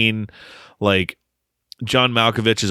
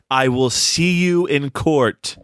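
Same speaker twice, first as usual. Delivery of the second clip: slow, higher theatrical actor impression; John Malkovich; 6 semitones higher, slower than usual. Co-host finishing the film quote, slow mock-grand threat. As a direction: draw out the threat in a put-on voice. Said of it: "finally sounds like the Malkovich I know".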